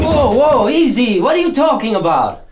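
calm him down while panicking; alarmed protest. Acted. Accused man backs off, alarmed.

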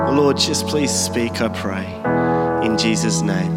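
ordinary talk

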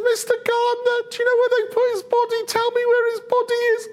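very high, hammed-up tearful squeal; Mary Magdalene. Distraught woman begging the gardener, hammed-up crying. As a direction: wail the plea in a mock crying voice. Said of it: "and she's crying".

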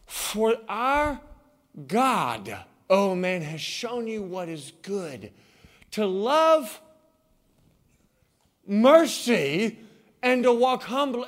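loud, ringing scripture read; fervent pulpit reading of Micah; preaching scripture. Acted. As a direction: proclaim the verse to the church. Reads scripture with force.

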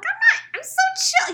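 very high, loud squawk; comically worked-up shriek; retold frantic denial. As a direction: protest shrilly, undermining the claim of calm. Acts out her frantic denial to boyfriend.

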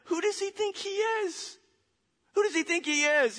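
very high, incredulous mock outrage; indignant outburst. Crowd reacts to Jesus's claim, indignant and high-pitched. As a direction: burst out high, scandalized.

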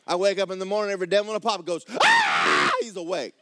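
loud, high preaching shout. Acted. Preacher shouting the devils' dismay to the church.

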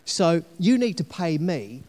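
a rough, self-mocking Cockney; Cockney salesman flattering, half-hearted; Cockney accent. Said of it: "I know my Cockney accent is awful".

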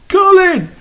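high, loud sudden call; shouting a name. Real, not acted. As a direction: yell his name mid-read. Calling out mid-announcement.

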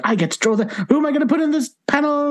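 high, fast, thrilled; giddy self-quote; excited. Retold or imitated. Retold own excitement.

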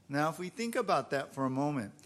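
ordinary talk

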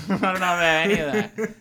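laughing it out. Real, not acted. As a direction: say it through a laugh. Repeats line, second laughing.

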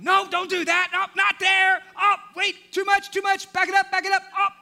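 high, loud shouts directing the crew; strained, excited hollering; shouting from the roof. Acted. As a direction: yell instructions down from the rooftop. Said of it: "And there's whispering".

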